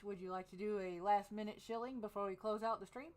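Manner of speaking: ordinary delivery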